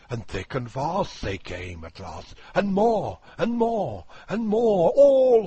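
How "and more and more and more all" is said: building excitement. A narrator's reading. Building list in recital.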